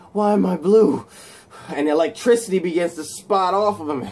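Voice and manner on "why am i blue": panicked. Retold or imitated. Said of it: "he begins freaking out".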